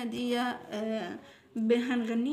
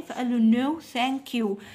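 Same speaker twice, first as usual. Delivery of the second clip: louder, slow no thank you with a clear fall; emphatic polite refusal; 5 dB louder, much slower than usual. Shown as a demonstration. Teacher modelling the grandpa's refusal, louder and slow. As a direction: model the answer so kids copy it.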